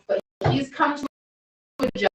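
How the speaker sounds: ordinary talk, nothing marked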